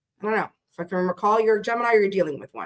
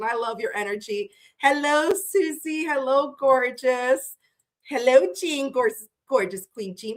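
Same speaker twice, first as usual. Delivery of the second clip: ordinary delivery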